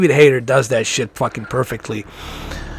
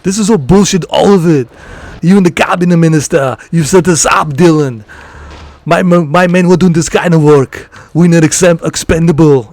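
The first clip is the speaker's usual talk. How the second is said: louder, higher action-movie Arnold rant; Arnold impression; 4 semitones higher, 5 dB louder than usual. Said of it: "I can do the Arnold".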